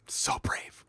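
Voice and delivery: whispered sarcastic awe; hushed sarcastic praise; mock awed whisper. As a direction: breathe it out as a mock-reverent whisper.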